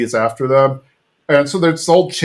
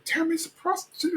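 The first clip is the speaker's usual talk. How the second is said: high, hushed mock secret; covert; 13 dB quieter than usual. Hank confronting Tammy in the library, high covert hush. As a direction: imitate someone trying to say it discreetly. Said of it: "trying to be covert".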